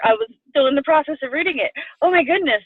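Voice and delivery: high, quick, breathless delight; excited gush. Retold or imitated. Telling her coach how thrilled she was, fast and high. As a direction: rush it out, thrilled. Retold excited reaction continues.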